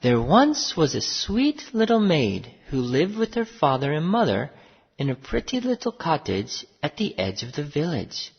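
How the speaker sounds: ordinary delivery, nothing marked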